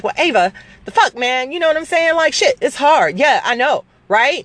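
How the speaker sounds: high, lively sister voice; sister's voice. Retold or imitated. Retelling sister's pep talk in her office, high and animated. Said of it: "She was like".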